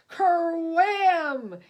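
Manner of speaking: loud, high sound effect cry; booming crash word. A narrator's reading. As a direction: shout the impact like a crash. Impact sound in story.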